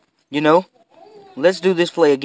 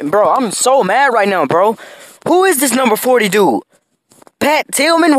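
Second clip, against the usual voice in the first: higher, exasperated ranting; frustrated rant; 7 semitones higher than usual. Real, not acted. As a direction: complain at the screen, fed up. Ranting about opponent's player.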